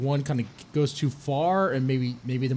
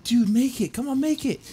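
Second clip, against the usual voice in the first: urging on; 8 semitones higher than usual. Retold or imitated. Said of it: "I'm like".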